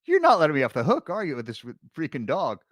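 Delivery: mock outburst, easing off after the first words; really mad. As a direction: act out an exasperated accusation. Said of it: "Allison's getting really mad".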